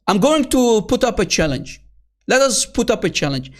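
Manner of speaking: normal talking voice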